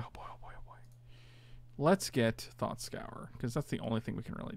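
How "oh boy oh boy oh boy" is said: nervous whisper to yourself; whispered dread. Real, not acted. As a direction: breathe it out in quiet dread. Dread muttered under breath.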